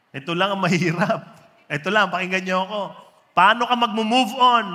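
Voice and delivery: loud, quick punchline; emphatic preaching. Acted. Preacher delivering the punchline to the congregation. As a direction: build fast and hit it loud.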